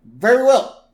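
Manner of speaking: loud, high put-on politician voice; Quimby. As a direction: proclaim it like a cartoon mayor. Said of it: "And Quimby's like".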